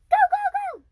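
high loud warning yell; urgent shout. Retold or imitated. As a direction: yell to flee danger. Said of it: "He said".

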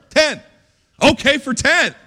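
high-pitched acted back-and-forth bargaining; haggling. Preacher acts out haggling with God, high-pitched bargaining.

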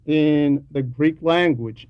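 normal talking voice

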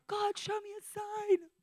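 high, loud, slow cry to the sky; pleading Jim Carrey. Retold or imitated. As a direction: beg heaven dramatically, hammed-up. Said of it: "that moment from Jim Carrey".

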